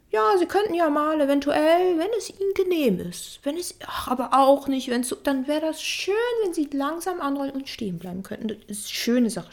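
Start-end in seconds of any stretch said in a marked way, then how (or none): 0.1-8.5 higher, louder, dithering polite voice; mock overly polite. A stop sign that politely begs drivers instead of commanding, higher mock-polite dithering. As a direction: simper like a timid sign afraid to give orders. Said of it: "da steht nicht irgendwie".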